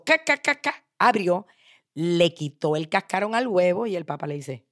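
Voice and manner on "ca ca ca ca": high, choppy cracking noise; quick pecking "ca" sounds, pitched up; imitated shell cracking. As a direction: mimic an egg being cracked open. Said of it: "Entonces ella le hizo".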